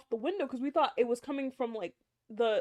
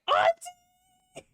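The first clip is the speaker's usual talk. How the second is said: high, loud panicked yell for auntie; frightened call; 4 dB louder than usual. Retold or imitated. Calling aunt in fright.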